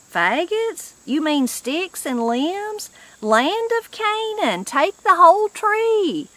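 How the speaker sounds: a high, hammed-up exclamation from an old country woman; high exclaiming old woman. A narrator's reading. Granny protests, offering whole tree.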